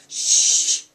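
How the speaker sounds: loud, sharp hissing shush; hissed shush. A narrator's reading. Professor silencing the howling giant, hissed shush. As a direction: hush them urgently with a hard hiss. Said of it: "hissed Professor McGonagall".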